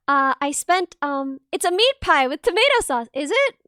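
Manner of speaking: ordinary talk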